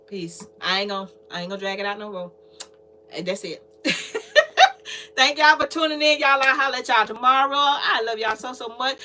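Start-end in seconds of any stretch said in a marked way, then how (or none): 3.8-5.1 a high, loud burst of laughter; howling laugh; loud cackling laugh. Real, not acted. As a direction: crack up out loud at the joke. Laugh before signing off.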